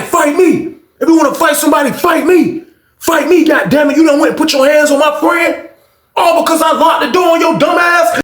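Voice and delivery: high, loud tirade; hammed-up furious inmate; acted angry challenge. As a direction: pick a fight at the top of your range. Acted shouting match.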